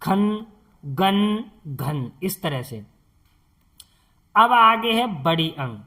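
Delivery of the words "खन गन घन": खन, गन stretched out very slowly; slow, deliberate reading of खन, गन for learners; drawn-out syllables. Shown as a demonstration. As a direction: spell out खन and गन one slow sound at a time. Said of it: "अब जैसे इसे पढ़ेंगे कन".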